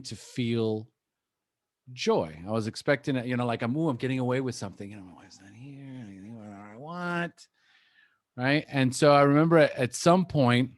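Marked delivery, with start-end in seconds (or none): 3.8-7.5 sneaky glee. Retold or imitated. Inner voice of relapse.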